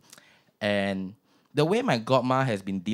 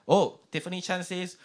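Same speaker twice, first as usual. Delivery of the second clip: ordinary talk, nothing marked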